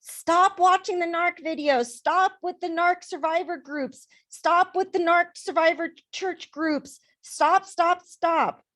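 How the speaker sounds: high-pitched mocking imitation of nagging relatives; put-on high, insistent scolding; imitating nagging family. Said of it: "The narcs in my family".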